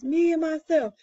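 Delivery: high, proud roared boast; chest-out lion roar. A narrator's reading. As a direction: roar it out like a proud king of beasts. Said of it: "He roared just once".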